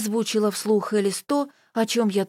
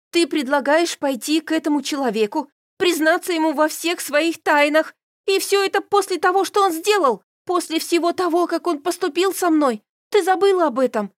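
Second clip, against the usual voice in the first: raised, rising indignation; indignant rant; 8 semitones higher, 4 dB louder than usual. A narrator's reading. Carol ranting at Elis about him, raised and indignant. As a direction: let the outrage build up high.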